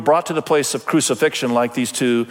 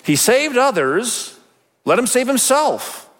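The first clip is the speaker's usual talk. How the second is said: high-pitched, drawn-out mockery; scoffing; 8 semitones higher, slower than usual. A narrator's reading. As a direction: scoff at a helpless victim. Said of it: "scoffed at him".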